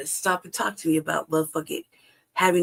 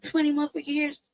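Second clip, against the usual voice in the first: high-pitched incredulous question; hater's disbelief; 9 semitones higher, 3 dB quieter than usual. Retold or imitated. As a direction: pitch it up like you doubt the claim. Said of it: "I'm being a hater".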